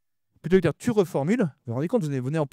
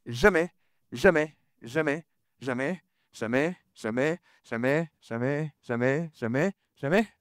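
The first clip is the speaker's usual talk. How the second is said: each jamais stretched slowly, insisting; drawn-out jamais; 3 dB quieter, much slower than usual. Shown as a demonstration. To the trainees, a slow insistent never, never. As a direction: drag out every jamais like a warning. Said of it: "S'il y a un truc à retenir".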